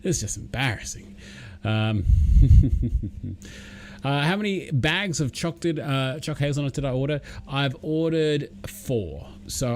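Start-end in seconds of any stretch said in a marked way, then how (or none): 2.1-4.0 chuckle. Real, not acted. Streamer laughs at his own poor viewer numbers, chuckling. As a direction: chuckle to yourself.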